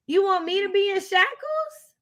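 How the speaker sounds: high, indignant rhetorical question; outraged high-pitched disbelief. Real, not acted. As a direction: scoff at the very idea.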